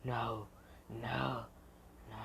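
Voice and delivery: very soft, spaced-out denial; hushed disbelief. Acted. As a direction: say it softly, stunned. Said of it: "And he's like".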